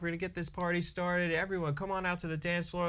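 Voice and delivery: lightly voiced MC, high and quick; party MC announcement. Wedding DJ calling everyone to the dance floor, retold MC announcement. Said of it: "I was like".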